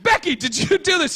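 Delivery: high accusing voice; accusing girl. Retold or imitated. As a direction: accuse Becky sharply.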